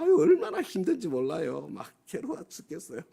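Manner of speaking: hammed-up groaning about how hard it is; imitating a complainer moaning for sympathy, high and whiny; mock whiny complaint. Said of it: "막 이러면서".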